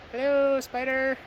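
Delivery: high cute greeting. Acted. Greeting a spider on the trail.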